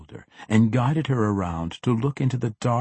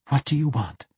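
lightly voiced captive woman, higher pitch; uneasy question; 5 semitones higher than usual. A narrator's reading. Captive woman asks her armed captor, uneasy question. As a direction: raise the pitch for a cornered woman.

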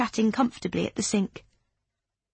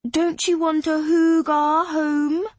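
coaxing; 8 semitones higher, 5 dB louder, much slower than usual. Acted. Coaxing partner.

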